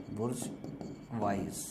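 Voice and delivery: बुर्जवाइज drawn out slowly and softly; slow teaching pronunciation of बुर्जवाइज; slow spelling-aid pronunciation. Shown as a demonstration. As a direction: stretch बुर्जवाइज syllable by syllable. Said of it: "बुर्जवाइज पढ़ सकते हो".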